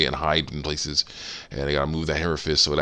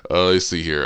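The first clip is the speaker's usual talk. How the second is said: ordinary talk, nothing marked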